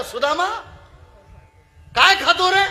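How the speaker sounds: high, loud call across the rain; Krishna calling out. Acted. (पावसात सुदाम्याला मोठ्याने हाक मारणारा कृष्ण, उंच आवाजात)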